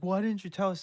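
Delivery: accusing. Retold or imitated. Townsfolk blaming the warner, in a mock accusing whine. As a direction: whine the blame at him. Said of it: "It's just like".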